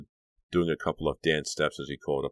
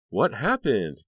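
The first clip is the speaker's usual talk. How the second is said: louder, higher demanding question; 8 semitones higher, 7 dB louder, slower than usual. Acted. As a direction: press her for an answer. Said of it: "Caroline demanded".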